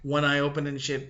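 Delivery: usual speaking voice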